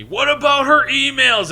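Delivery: high, loud, slow mock confrontation; hammed-up aggressive barfly; aggressive mock heckle. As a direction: imitate a pushy bar guy ranting. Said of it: "not quite yelling, but aggressively saying at me".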